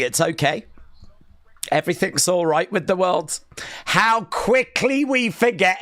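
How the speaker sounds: usual speaking voice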